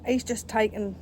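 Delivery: ordinary talk, nothing marked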